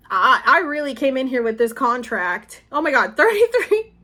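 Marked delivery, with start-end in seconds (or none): 2.7-3.9 laughing in surprise, much higher and louder; sudden laughing surprise at the clock; real startled laugh; surprised laugh. Real, not acted. Surprised by the time.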